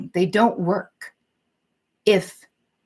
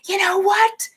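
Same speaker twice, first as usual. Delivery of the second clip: snapping after bottling it up; 14 semitones higher, slower than usual. Retold or imitated. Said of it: "you're just like".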